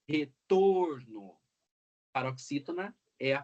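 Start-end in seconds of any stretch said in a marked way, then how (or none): 0.1-1.4 retorno very slowly, TOR stretched; stress on penultimate syllable. Shown as a demonstration. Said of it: "o acento recai na penúltima sílaba".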